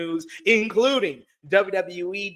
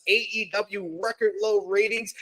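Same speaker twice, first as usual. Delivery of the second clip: ordinary talk, nothing marked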